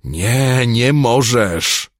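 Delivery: very high, loud, slow pleading shriek; panicked wail; terrified scream. A narrator's reading. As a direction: beg for your life at the top of your voice. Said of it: "wrzasnął Mimen".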